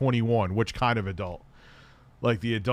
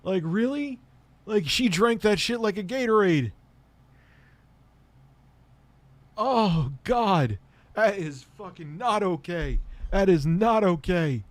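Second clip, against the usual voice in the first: disgusted; 8 semitones higher than usual. Real, not acted. Disgusted reaction to story.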